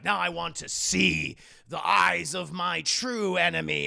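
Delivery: loud, slow, villainous declaration; menacing demand. Acted. Kingpin towers over the beaten hero, loud and slow. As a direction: boom it out slowly like a movie villain.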